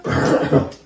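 real coughing fit; coughing on pepper fumes. Real, not acted.

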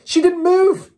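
high, loud outcry; crying out. A narrator's reading. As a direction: shout it out in protest. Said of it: "they cried out".

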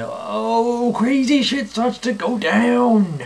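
high, slow, drawn-out warning; hammed-up high doom voice; dramatic alarm. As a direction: stretch it out high like chaos is coming.

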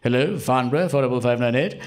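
loud, formal telephone greeting, pitch lifting at the end. Retold or imitated. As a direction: imitate Dad proudly answering the telephone. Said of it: "my dad had a telephone voice".

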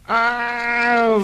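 sudden high squeal; shrill comic yelp. Acted. Comic shriek sound.

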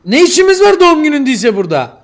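loud, high yell of outrage; exasperated angry shouting; furious shout. Real, not acted. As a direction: demand an answer at full volume. Keeps yelling after told to calm down.

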